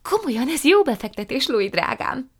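high, loud, keen pitch; eager. A narrator's reading. Prue enthusiastically pitching dog breeding to her aunt. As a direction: sell it with enthusiasm. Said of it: "mondta Prue lelkesen".

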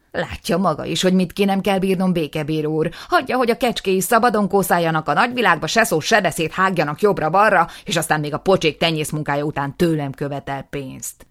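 loud, rushed litany; aggrieved complaint. Acted. Aftab complaining to the justice, loud and rushed. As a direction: pour out the grievance.

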